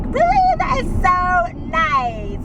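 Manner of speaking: high, slow sarcastic gush; over-the-top sarcastic thanks; mock delight. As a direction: fake gratitude to the boss. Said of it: "And I was like".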